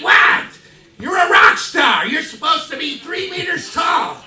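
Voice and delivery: high, loud incredulous shouting; hammed-up outraged protest; shocked. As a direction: rant in comic disbelief. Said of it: "And so I was shocked".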